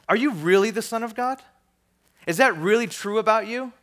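doubting tempter. Acted. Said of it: "Jesus was tempted into false identities".

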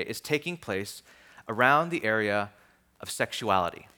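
ordinary talk

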